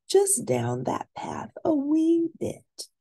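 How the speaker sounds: put-on high, sing-song greeting from a fussy old librarian; kindly librarian voice. Librarian greeting the princesses, high sing-song voice. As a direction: chirp it out like a cheerful librarian.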